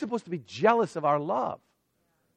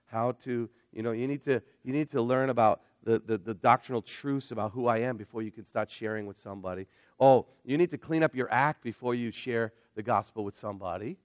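mock Jesus; 8 semitones lower, 5 dB quieter than usual. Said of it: "Jesus says".

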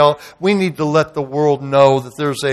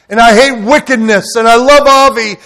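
louder, higher, each word hit; outraged declaration; 7 semitones higher, 6 dB louder than usual. Retold or imitated. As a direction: proclaim it with fury. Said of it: "And I was outraged".

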